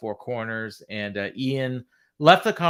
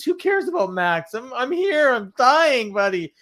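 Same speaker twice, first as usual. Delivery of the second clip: whiny dying man; 11 semitones higher, 5 dB louder than usual. Retold or imitated. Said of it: "And Roddy says".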